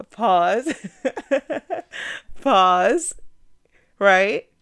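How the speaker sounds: laughing out the word pause, loud and high; laughing loudly. Real, not acted. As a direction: break into a real laugh.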